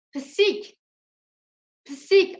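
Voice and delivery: Pisik first with ı then with i; Pisik, two vowels shown; vowel contrast. Shown as a demonstration. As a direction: say Pisik with a back ı, then a front i. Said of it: "nasıl söylediğime bakın. İlki I".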